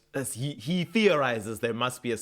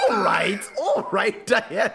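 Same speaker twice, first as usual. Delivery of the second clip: loud, laughing, higher pleading to Diana; exasperated laughing plea; 9 semitones higher, 5 dB louder than usual. Retold or imitated. Podcaster voicing herself begging Diana, laughing exasperation. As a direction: throw up your hands and laugh it out.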